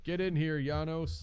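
loud high call; loud summons. Pretend. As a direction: bark the order. Voicing Truman summoning.

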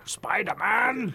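slow drawn-out crime boss call; Kingpin impression. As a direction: stretch it like a cartoon villain. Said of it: "dive in with our Kingpin impression".

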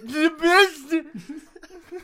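high, loud, mangled battle cry; shrieked, garbled cry of a man hit on the head; punch-drunk character's yell. Acted. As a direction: yell the name with its sounds squashed together. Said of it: "he just kinda goes".

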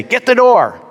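high-pitched imitation of a woman calling out; woman's voice calling. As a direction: mimic a woman yelling from inside, pitched well up. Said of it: "a woman's voice inside say".

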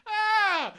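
high, loud scream. Acted. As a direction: shriek in terror. Alien attack cry.

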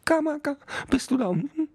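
tiny high-pitched beetle calling out; small insect voice, called up the ship. Acted. Beetle calls again.

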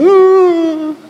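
sudden high, loud whoop; excited mock woo; organist's woo. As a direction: mimic an organist whooping with joy. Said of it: "We'd have the organist going".

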